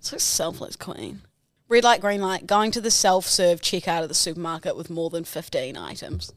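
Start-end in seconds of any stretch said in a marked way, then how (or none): 0.0-1.2 breathy whisper, teasing; whispered mock praise. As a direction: whisper it like a sly compliment.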